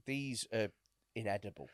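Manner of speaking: soft, meek excuse me; timid quiet. Retold or imitated. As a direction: say it timidly, barely there. Timid complaint to server.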